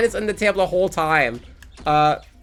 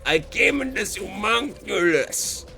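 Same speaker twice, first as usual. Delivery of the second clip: hammed-up Russian accent impression; 4 semitones higher than usual. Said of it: "trying to do the Russian accent".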